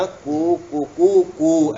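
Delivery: imitating a rooster's ku ku ku, slowly; rooster call. Shown as a demonstration. Teacher imitating a rooster for the class, slow ku calls. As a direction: mimic a cock crowing ku, ku, ku. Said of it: "ऐसा बोलता है ना".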